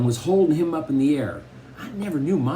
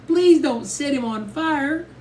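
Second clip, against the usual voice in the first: very high, drawn-out wailing plea; hysterical pleading; 4 dB louder, slower than usual. A narrator's reading. Little sister begs mother to spare brother, hysterically. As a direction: beg slowly at the top of the voice. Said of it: "she looked like a real nut".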